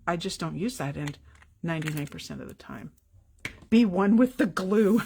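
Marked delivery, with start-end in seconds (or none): 3.7-5.0 mock guru. Mock zen advice, then laughs.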